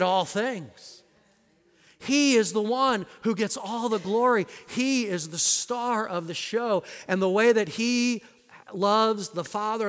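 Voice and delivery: full-force pulpit proclamation; preaching. Acted. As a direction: proclaim it to the congregation.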